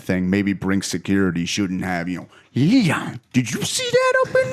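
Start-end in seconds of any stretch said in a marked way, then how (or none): 2.6-4.5 exaggerated falsetto caricature; caricatured character voice. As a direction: squeal it in an over-the-top high voice. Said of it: "doing an example of a bad thing".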